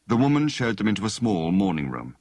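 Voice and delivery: ordinary delivery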